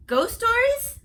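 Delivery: high, loud startled question; alarmed surprise. Acted. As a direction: gasp it out. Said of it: "I said".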